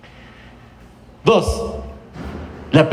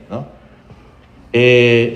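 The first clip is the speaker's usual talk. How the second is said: usual speaking voice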